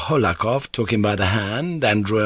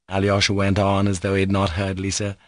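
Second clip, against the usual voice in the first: ordinary delivery, nothing marked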